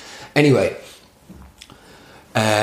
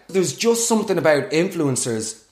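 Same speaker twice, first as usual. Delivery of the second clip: ordinary delivery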